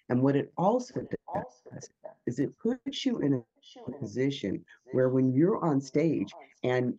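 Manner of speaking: ordinary delivery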